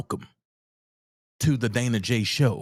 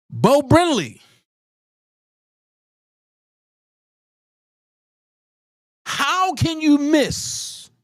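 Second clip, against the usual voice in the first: much higher, slow, disbelieving challenge; exasperated outburst; 8 semitones higher than usual. Real, not acted. Host calls out Bo Brinley for missing the point, exasperated. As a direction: demand an answer, pitch climbing in outrage.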